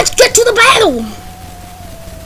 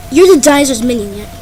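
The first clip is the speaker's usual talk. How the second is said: ordinary talk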